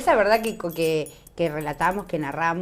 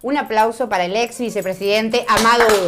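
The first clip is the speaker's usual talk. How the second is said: announcer introduction; 2 semitones higher, 7 dB louder than usual. Acted. Host introduces guest for applause.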